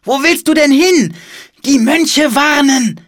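put-on high, loud, urgent child's voice; urgent calling. A narrator's reading. Siblings rushing to warn the monks, urgent calling. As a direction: call out in a high-pitched hurry.

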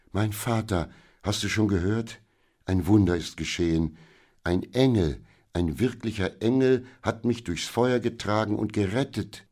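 thrilled, emphatic announcement; excited daughter. Acted. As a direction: gush the miracle to your father. Rescued daughter runs to father, amazed.

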